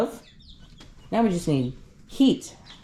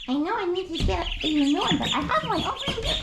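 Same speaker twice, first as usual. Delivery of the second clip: much higher fond voice to the ducks; high-pitched talk to ducklings; pet talk; 12 semitones higher than usual. Real, not acted. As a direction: chat up to the birds in a high voice. Talking to ducks while catching.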